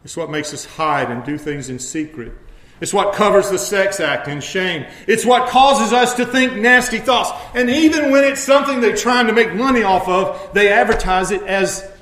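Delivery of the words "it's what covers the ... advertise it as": higher, louder preacher's cry against sin; preaching at full force. Acted. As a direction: build to a shout over sin. Preacher building a list.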